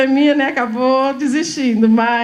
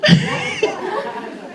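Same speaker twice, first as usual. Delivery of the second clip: a squeal of laughter, much higher. Real, not acted. Teacher laughing at a child's line, high squeal.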